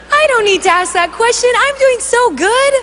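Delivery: high, quick protesting cheer ending in a laugh; nervous, over-eager denial; nervous overconfidence. Acted. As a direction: insist brightly that all is great, then laugh nervously. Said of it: "The nervous laugh".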